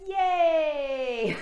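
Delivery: high, loud cheer breaking into a laugh; joyful yay into laughter. Shown as a demonstration. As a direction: whoop and then laugh freely. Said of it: "very good yay".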